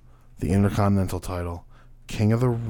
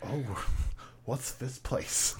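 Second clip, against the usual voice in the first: whispered slow bewildered question; puzzled whisper; 12 dB quieter, much slower than usual. Retold or imitated. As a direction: whisper in confusion. Wrestler puzzled in roofless room.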